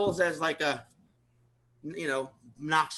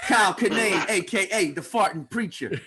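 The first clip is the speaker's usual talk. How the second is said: loud, raised stage introduction; mock announcement; 4 semitones higher, 5 dB louder than usual. Friend announcing a comic's hip hop alias, mock hype. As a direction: announce it big like a rapper's alias.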